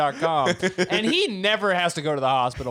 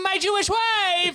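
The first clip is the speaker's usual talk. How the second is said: high squeaky voice; slower than usual. Acted. Acting out the panicked answer at heaven.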